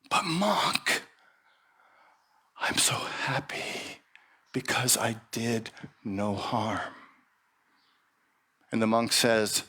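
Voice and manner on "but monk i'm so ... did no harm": faint whispered words; pained whisper. Acted. Hurt snake whispering to the monk. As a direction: barely get it out through the pain.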